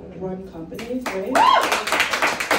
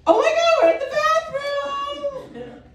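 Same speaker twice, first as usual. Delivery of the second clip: high, slow mock-cheerful sing-song; imitating a perky coworker in the bathroom, much higher; Heather's sing-song; 14 semitones higher, 5 dB louder, slower than usual. Coworker Heather greeting her in the bathroom, high mock-cheerful sing-song. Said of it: "She's like".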